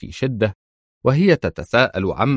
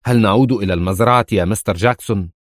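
ordinary delivery

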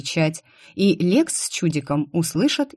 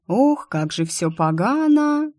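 slow, high drawn-out lament; 7 semitones higher, slower than usual. A narrator's reading. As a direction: drag the words out in dismay. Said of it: "протянула я". (протяжно и жалобно, выше и медленнее)

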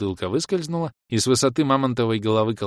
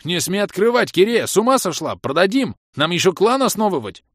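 alarmed yelling; 10 semitones higher, 6 dB louder than usual. A narrator's reading. Bom yells to stop her.

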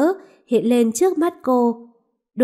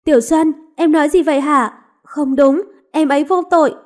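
higher and louder, urgent objection; alarmed protest; 4 semitones higher, 5 dB louder than usual. Acted. Mistress protesting that her maid is innocent, alarmed. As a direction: cut in to defend your maid.

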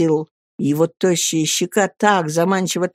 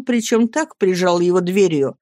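ordinary delivery

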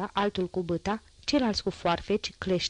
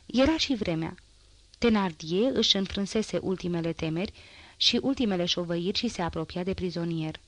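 usual speaking voice

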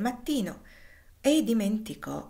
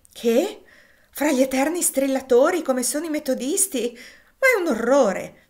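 higher, louder indignant outcry; scandalized lady, voice raised; shocked exclamation; 5 semitones higher, 7 dB louder than usual. A narrator's reading. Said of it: "Esclamò Maria".

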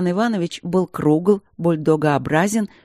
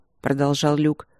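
usual speaking voice

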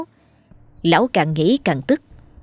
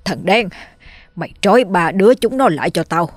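cruel order. Acted. Landlord ordering the servant to burn the family, rising shout.

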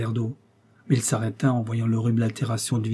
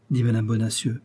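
plain reading voice

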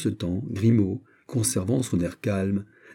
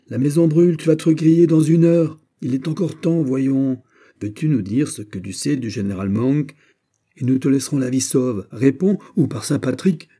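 raised, higher shouted summons; threatening call; 5 semitones higher, 6 dB louder than usual. Acted. Officer summons the man in the burning house, threatening.

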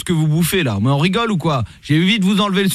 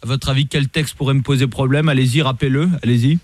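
lightly voiced lower police commissioner; stern police officer; 4 semitones lower than usual. Host posing as police commissioner calling the rapper, stern. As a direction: play a stern cop on the phone. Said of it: "Je suis le commissaire Latour".

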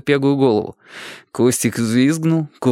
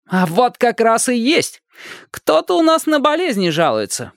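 put-on much higher cheeky boy voice; teasing boy; 14 semitones higher than usual. A narrator's reading. Upside-down boy teases the neighbours, high and cheeky. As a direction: needle them like a little brat.

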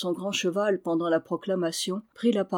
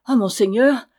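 louder, higher, fear suddenly gone; eager interruption; 3 semitones higher, 8 dB louder than usual. Acted. Boy interrupts the knight, eager. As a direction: jump in eagerly. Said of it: "interrompit Jeannin, oubliant tout à coup ses craintes".

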